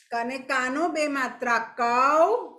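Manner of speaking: ક અને કાનો બે માત્રા કૌ, slow and stretched, rising on કૌ; slow spelling aloud. Shown as a demonstration. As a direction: dictate it slowly to the class, rising on કૌ. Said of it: "ફરીથી આપણે બોલશું". (બાળકોને ધીમે ધીમે બોલાવતી શિક્ષિકા)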